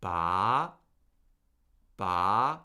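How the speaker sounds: "Ba" gliding steadily upward; questioning upward lift on Ba; second tone. Shown as a demonstration. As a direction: start low and rise through each "Ba". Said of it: "raise the pitch of our voice".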